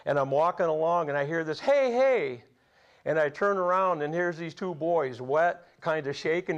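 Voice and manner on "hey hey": higher, louder call to get his attention. Retold or imitated. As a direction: shout after him from behind. Boys calling after him.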